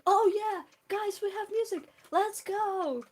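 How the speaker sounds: loud, high burst of joy; excited cheer. Real, not acted. Streamer cheering to chat as music comes on, loud and thrilled. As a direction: cheer like the party just started.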